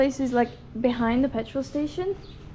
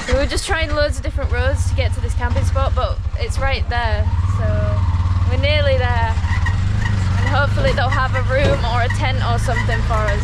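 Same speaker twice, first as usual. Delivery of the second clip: raised voice over engine noise; loud; 7 dB louder than usual. Real, not acted. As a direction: speak up over the tuk-tuk.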